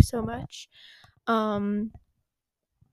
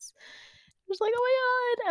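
shocked; 12 semitones higher, 9 dB quieter, slower than usual. Retold or imitated. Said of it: "in shock".

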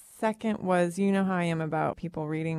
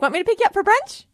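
chirpy friend voice; 13 semitones higher, 11 dB louder, faster than usual. Retold or imitated. Imitating friend's cheerful invitation.

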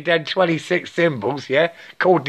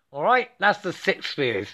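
ordinary talk, nothing marked

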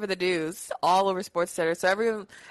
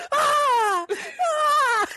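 high screaming ah ah, cracking with laughter; retold screaming while laughing; laughing mock scream; 5 dB louder than usual. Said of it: "would you stop screaming".

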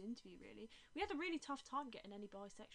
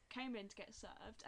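ordinary talk, nothing marked

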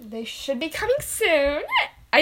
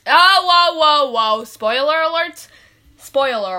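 loud interruption; 2 semitones higher, 10 dB louder than usual. Acted. Stopping a spoiler.

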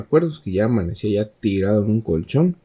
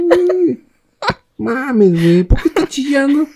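voz muy aguda y fuerte de burla; 5 dB louder, slower than usual. Retold or imitated. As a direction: remeda al hermano exagerando. Imita al hermano.

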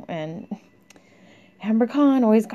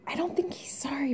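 hushed, breathy whisper, a little higher; 7 semitones higher, 10 dB quieter than usual. Real, not acted. Telling viewers about the ex, in a whisper. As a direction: let it slip out as a whisper.

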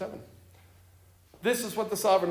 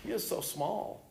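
much softer, slow pleading; softened plea; 4 semitones higher, 6 dB quieter, slower than usual. A narrator's reading. Amos pleads for Jacob, softly and slowly. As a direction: let the plea fall to a hush. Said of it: "I cried out".

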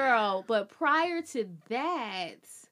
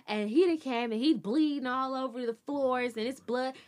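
ordinary talk, nothing marked

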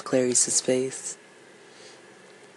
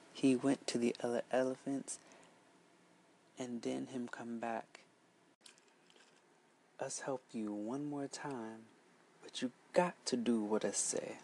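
much softer, almost hushed telling; hushed narration; 13 dB quieter than usual. A narrator's reading. The teller hushes the children listening, much softer. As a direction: draw listeners in with a hush. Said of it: "storyteller drops very soft".

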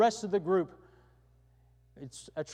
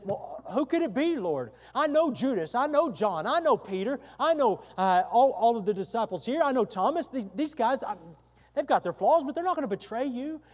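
raised, earnest voice of the disciples defending each other; protesting disciples; 6 semitones higher, 6 dB louder than usual. Acted. Disciples insisting to Jesus they trust each other, raised and earnest. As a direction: plead with the Lord that none of them would do it.

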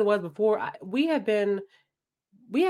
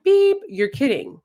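disbelief; 7 semitones higher, 6 dB louder than usual. Retold or imitated. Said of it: "I said".

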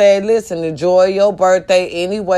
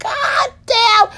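high squealed god damn; amazed. Retold or imitated. Reacting to the ring, squealing high in amazement. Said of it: "I was like".

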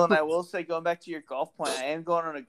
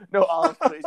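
higher, louder prim customer voice; mock polite customer; 12 semitones higher, 7 dB louder than usual. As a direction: play the fussy customer, pitched up. Voicing customer ordering.

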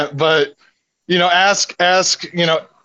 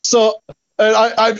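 usual speaking voice

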